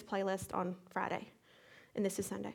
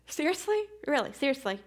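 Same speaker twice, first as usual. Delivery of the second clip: much higher incredulous rise; incredulous exclamation; exasperated disbelief; 4 semitones higher than usual. Retold or imitated. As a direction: throw up your hands, seriously?. Reacting to cat peeing.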